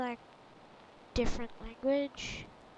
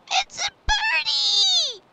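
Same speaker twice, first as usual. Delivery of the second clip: very high, loud, drawn-out squeal; excited squeal; 15 dB louder, slower than usual. Real, not acted. Game player spotting a bird, excited high squeal. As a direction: squeal it with delight, slow and piercingly high.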